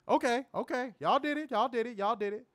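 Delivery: high, excited cheering; excited celebration. Real, not acted. Celebrating the likes goal.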